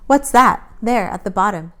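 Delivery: loud, sharp tense question; suddenly suspicious. A narrator's reading. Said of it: "a different, tenser voice".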